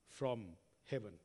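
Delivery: ordinary talk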